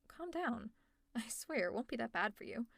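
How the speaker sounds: ordinary delivery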